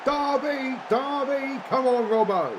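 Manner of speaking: high, loud football chant; cheering shout. Real, not acted. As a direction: roar your team on from the sofa. Cheering the team while gaming.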